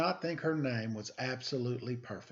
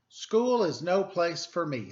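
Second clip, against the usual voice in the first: put-on glum child; 6 semitones higher, 8 dB louder than usual. A narrator's reading. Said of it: "said Chrysanthemum".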